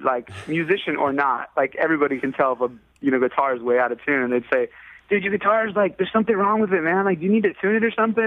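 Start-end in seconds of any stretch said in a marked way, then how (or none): 5.1-8.2 high, fast laid-back dude voice; a lightly voiced surfer-type buddy pointing it out; mock dude friend. Friend points out bad tuning.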